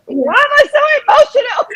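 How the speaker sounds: high-pitched retold crying wail; hammed-up emotional lament; mock tearful. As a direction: wail it high, poking fun at your own tears. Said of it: "I was just crying. I'm like".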